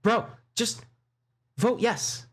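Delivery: high-pitched voice of Luna; squeaky mock Luna voice; imitating Luna. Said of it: "Luna's just like".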